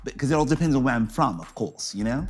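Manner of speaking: halting attempt at posh British; posh British accent. Shown as a demonstration. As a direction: put on a British accent, searching for words. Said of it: "how's your British accent".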